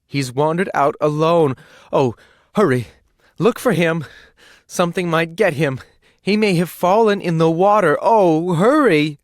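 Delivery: loud, high panicked pleading; desperate mother begging them to hurry; frantic mother. A narrator's reading. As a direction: cry out for the lost child. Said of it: "cried the woman".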